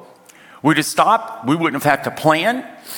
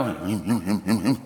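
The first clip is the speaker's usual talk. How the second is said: mock eating grunts; 7 semitones lower, 7 dB quieter than usual. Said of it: "They don't go".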